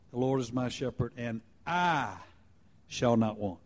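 brief pause after a weighted "and I"; stress on I. Shown as a demonstration. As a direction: lean on "I" before a soft "shall not want". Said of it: "emphasizing a different word each time".

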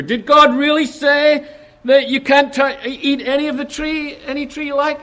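high, sly doubting serpent voice; tempter's question. Acted. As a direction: plant the doubt, high and insinuating. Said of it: "he's saying to her".

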